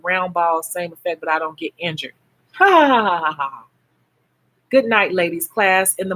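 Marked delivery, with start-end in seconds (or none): 2.5-3.6 laughing. Real, not acted. Laughing at her own joke.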